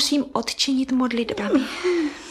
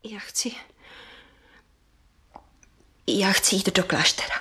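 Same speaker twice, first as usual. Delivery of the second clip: barely voiced, much softer whisper; whispered confession; 4 semitones lower, 4 dB quieter than usual. Niece confesses to her aunt in a faint whisper. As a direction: confess it in a breath.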